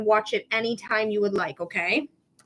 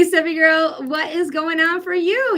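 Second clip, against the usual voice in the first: normal talking voice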